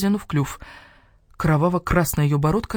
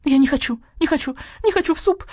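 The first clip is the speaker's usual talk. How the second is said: wailing hen, much higher; clucking sobs; 12 semitones higher, 3 dB louder than usual. Acted. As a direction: cry out in panic. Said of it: "разразилась кудахчущим плачем". (с плачем, высоко)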